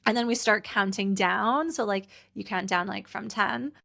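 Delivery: ordinary talk, nothing marked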